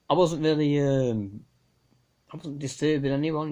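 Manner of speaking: ordinary talk, nothing marked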